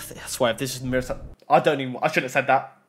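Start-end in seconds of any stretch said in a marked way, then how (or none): 0.1-1.2 dread-filled whisper under the breath; hushed nervous whisper. Real, not acted. Sighs before a dreaded pull.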